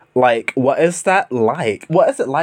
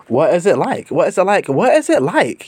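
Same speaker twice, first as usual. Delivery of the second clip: rising insistence; 5 semitones higher than usual. Real, not acted. Repeated question, pitch climbing.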